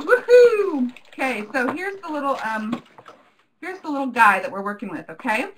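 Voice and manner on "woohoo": put-on loud, high, drawn-out accent with a cheer; [name]'s accent. Host slipping from Hazel's voice into her own accent, cheering. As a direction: whoop it out in a big accent. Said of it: "quickly turning into [name]'s accent".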